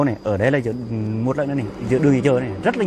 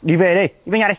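loud, higher urging to come home; raised voice; 4 semitones higher, 5 dB louder than usual. Acted. Husband to his wife in the street, loud and pressing. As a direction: push her to leave, voice raised.

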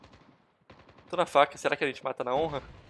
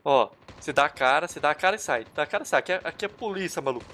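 ordinary talk, nothing marked

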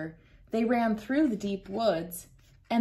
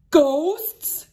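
high, loud frightened cry; startled cry; 7 semitones higher, 9 dB louder than usual. Acted. The frightened boys spotting ghosts, high scared cry. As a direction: shriek the word in alarm.

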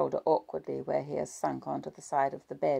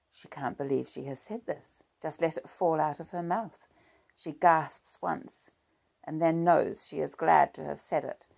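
ordinary delivery, nothing marked

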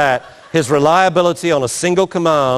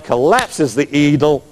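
usual speaking voice

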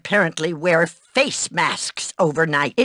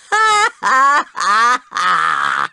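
gloating evil laugh; 4 dB louder than usual. Acted.

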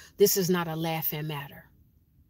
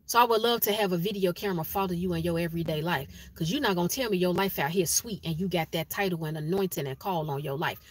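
normal talking voice

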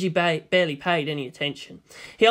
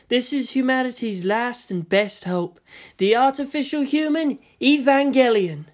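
proud unveiling; 6 semitones higher, 3 dB louder than usual. Acted. Ritsuko proudly presenting the Evangelion to Shinji, grand. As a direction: unveil it like a marvel.

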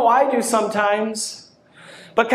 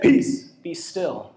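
1 calm command; 7 semitones lower, slower than usual. Retold or imitated. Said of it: "Jesus woke up and he said".

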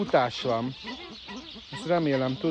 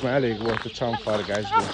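ordinary talk, nothing marked

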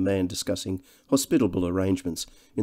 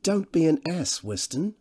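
more serious Devine; 9 semitones higher than usual. A narrator's reading. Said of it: "in a more serious voice".

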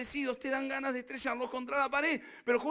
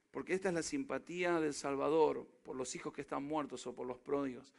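hushed; 8 semitones lower, 5 dB quieter than usual. Real, not acted. Pastor drops voice for tender point.

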